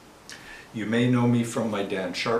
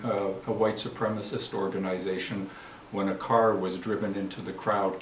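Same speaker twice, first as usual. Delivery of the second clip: ordinary delivery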